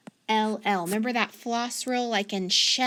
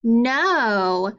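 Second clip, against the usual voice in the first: normal talking voice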